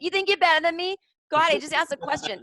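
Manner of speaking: high, loud indignant comeback; mock a touchy Boston local taking offence; defensive Bostonian. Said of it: "these defensive Boston people".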